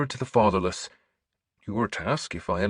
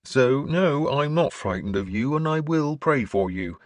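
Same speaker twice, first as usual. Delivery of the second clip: higher-pitched bishop, firmly insistent; 7 semitones higher, 4 dB louder than usual. A narrator's reading. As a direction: assure him firmly, lifting the pitch. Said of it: "Leofstan interrupted me firmly".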